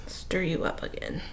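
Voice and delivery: breathy whisper to herself. Real, not acted. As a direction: whisper it under your breath. Muttering to herself over a spill.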